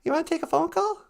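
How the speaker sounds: put-on sing-song old lady; high grandma voice. Grandma character.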